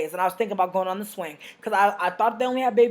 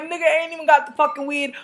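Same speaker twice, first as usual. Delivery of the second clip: roasting; 7 semitones higher, 4 dB louder than usual. Retold or imitated.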